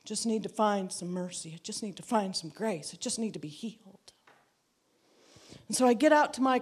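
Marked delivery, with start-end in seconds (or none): 0.0-4.1 much softer, half-muttered to herself; inner reply. Retold or imitated. [name] answering Donnie only in her head, much softer and fast. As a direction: hush it like a private thought. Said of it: "And I'm like".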